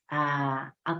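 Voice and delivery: ordinary delivery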